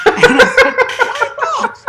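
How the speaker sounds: high, loud laughing question. Real, not acted. Asking Florence why not, laughing loudly.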